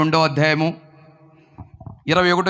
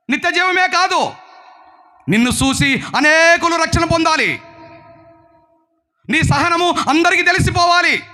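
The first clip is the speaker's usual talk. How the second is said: high-pitched, driving sermon delivery; forceful preaching; 11 semitones higher than usual. Real, not acted. Preacher urging Christians to live with zeal, forceful preaching.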